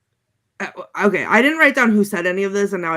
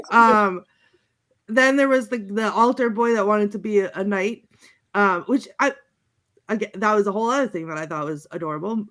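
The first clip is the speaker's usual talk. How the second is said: ordinary delivery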